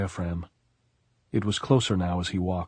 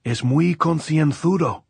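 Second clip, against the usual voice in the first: slow, high, loud call; shouting; 8 semitones higher, 6 dB louder than usual. A narrator's reading. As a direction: shout it slowly across the distance. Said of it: "Anselmo shouted".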